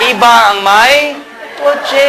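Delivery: slow, drawn out phrase; slow, drawn out emphasis. Real, not acted. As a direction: stretch each word for weight. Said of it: "slowed emphasis".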